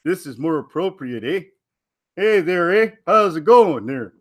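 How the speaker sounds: hammed-up Canadian "eh" accent; playful mock-Canadian greeting. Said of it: "That's, that's more Canadian".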